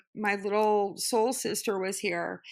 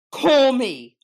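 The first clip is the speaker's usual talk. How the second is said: loud, sharp order; loud furious command; angry command; 3 semitones higher, 10 dB louder than usual. Retold or imitated. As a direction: shout it down the line. Retold angry demand.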